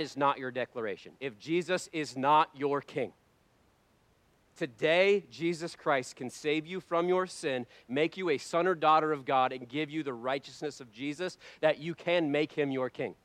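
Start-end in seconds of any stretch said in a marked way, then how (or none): none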